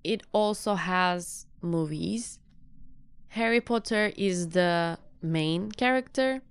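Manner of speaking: slow, careful beginner English with long pauses; slow simple English. Shown as a demonstration. Teacher demos summarising a book aloud in slow, simple English. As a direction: speak each short sentence slowly, as a learner would. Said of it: "basit cümlelerle böyle çok karmaşıklaştırmadan konuşmaya çalışıyorum".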